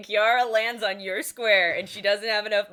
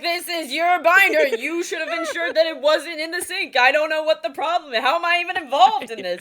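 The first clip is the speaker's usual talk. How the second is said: high, louder mock-callous dad, laughing; 7 semitones higher, 4 dB louder than usual. Wife mimicking husband dismissing the soaked cards. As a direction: play the unbothered dad shrugging it off. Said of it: "You're like".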